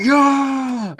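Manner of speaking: high, drawn-out cheer; mock crowd scream. Fan imitating everyone screaming at the kiss, mock scream. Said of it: "starts screaming".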